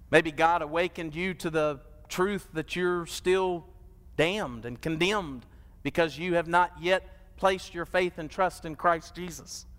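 usual speaking voice

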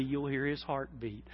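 ordinary talk, nothing marked

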